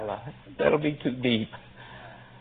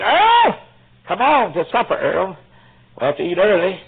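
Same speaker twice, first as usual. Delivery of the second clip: high, loud call to the next room; calling to supper; 6 semitones higher, 7 dB louder than usual. Retold or imitated. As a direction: call him in to eat. Wife calling husband to supper.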